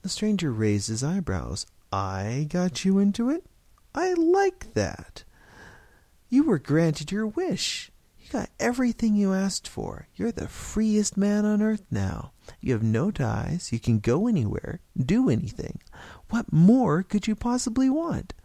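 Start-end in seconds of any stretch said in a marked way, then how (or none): 1.9-12.3 scornful stranger scolding him; indignant retort. A narrator's reading. Stranger indignantly answers George. 12.6-18.3 mounting exasperation to a high question; exasperated stranger listing freedoms; indignant retort. A narrator's reading. Stranger indignantly answers George.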